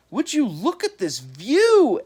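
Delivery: amazed. A narrator's reading. Eddie amazed.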